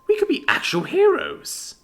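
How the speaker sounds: put-on dreamy eager boy voice lifting high. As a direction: sell the dream of being heroes, high and keen. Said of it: "said in an eager voice".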